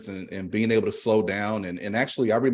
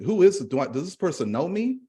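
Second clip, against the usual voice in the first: puzzled; 7 semitones higher than usual. Retold or imitated. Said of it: "they're thinking".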